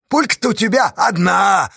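high, loud, gleeful jeer; merry taunting. A narrator's reading. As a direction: point it out cheerfully at full voice. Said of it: "так же весело пояснил".

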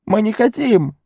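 high shout; Gollum shouting. A narrator's reading. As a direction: fling it out as a shout. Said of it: "крикнул он".

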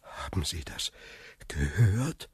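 very soft, low, near-whispered question; hushed question. Acted. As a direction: ask it under your breath, low and uneasy. Frightened guest asks after hearing. (leise, fast geflüstert)

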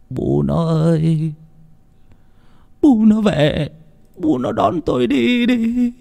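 slow, high, drawn-out weeping plea; tearful lamenting. A narrator's reading. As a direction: wail weakly to an absent spouse. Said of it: "lẩm bẩm". (than khóc ai oán, kéo dài)

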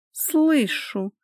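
high-pitched, bitter grudging reply; through clenched teeth. A narrator's reading. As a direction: squeeze it out full of bile. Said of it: "процедила она сквозь зубы". (процедить сквозь зубы, желчно)

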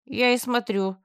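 sarcastic drawl. A narrator's reading. Woman mocking the orc's principles, sarcastic drawl. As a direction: drip sarcasm on every word. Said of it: "с сарказмом протянула я".

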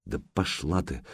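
low-energy, soft muttered brush-off. A narrator's reading. Sanyok brushes Alyona off after the scare, muttering. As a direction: mumble the curse under your breath. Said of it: "пробормотал Санёк".

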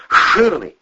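high, loud yell; sudden sharp shout; bellowed command. A narrator's reading. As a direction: bellow it into his ear. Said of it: "гаркнул в ухо Любчику Андрей".

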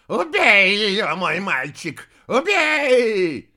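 very loud, high, drawn-out shriek; screeching. A narrator's reading. Old woman in mirror urging her boy, screeching. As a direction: shriek the order to kill. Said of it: "завизжала из зеркала старуха".

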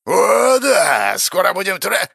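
high, loud crowing with a cry of joy; a high crowing cheer from the spirit of wrath; gleeful. A narrator's reading. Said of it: "ликовал гнев".

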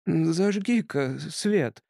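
slow, otherworldly hiss; eldritch horror. A narrator's reading. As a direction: hiss like a ghost from beyond. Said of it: "потусторонним голосом прошипел Тримбл".

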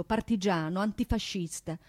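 ordinary talk, nothing marked

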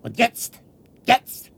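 building to a very high shriek; escalating scream. Acted. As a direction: drive them out in hysteria.

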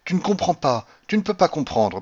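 high, drained delivery; toneless voice. A narrator's reading. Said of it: "prononça Cordelia d'une voix blanche". (voix blanche, plus haute)

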